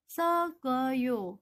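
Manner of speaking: "sogoyo" with the double consonant carried into "oyo"; Korean demo word. Shown as a demonstration. Teacher models the Korean "sogoyo" for students. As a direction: read "sogoyo" as one flowing word.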